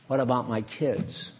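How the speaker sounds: low, soft mother's objection; subdued worried question. Acted. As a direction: ask it low and quietly, as an acted worry. Voices worried mother answering God.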